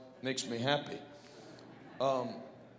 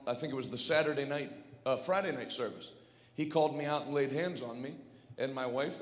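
ordinary talk, nothing marked

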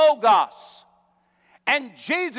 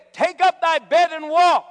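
higher, louder, commanding call; preached command; 8 semitones higher, 8 dB louder than usual. Acted. Preacher quoting what believers are to say, loud command.

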